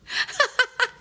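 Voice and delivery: high-pitched burst of laughter; real laugh at the absurdity. Real, not acted. Storyteller amused by her own breakup tale, laughing.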